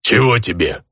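growling orc over phone. A narrator's reading. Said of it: "рыкающий без воздействия речевого модулятора голос".

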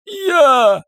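high strained gasp; choking rasp. Acted. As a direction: force it out while suffocating. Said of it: "прохрипел задыхающийся Шон Хон".

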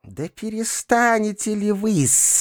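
slow, high-pitched squeal; squeaking. A narrator's reading. Perepelitsyna scolds uncle, squeaking. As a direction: squeak the reproach in a pinched character voice. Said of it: "запищала опять Перепелицына".

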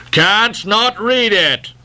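crying out. A narrator's reading. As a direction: call out the question high and drawn out. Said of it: "cried Ahab".

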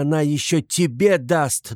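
plain reading voice

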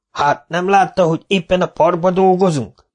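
high, exasperated yell. Acted. Foreman yelling at the old man, exasperated. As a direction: throw the question at him. Micu protests.

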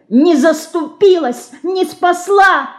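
put-on very high, slow angry child's shout; little girl crying out in anger. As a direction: hurl the blame at the icon. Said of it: "гневно кричала мышка".

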